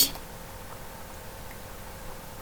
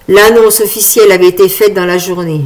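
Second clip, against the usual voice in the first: usual speaking voice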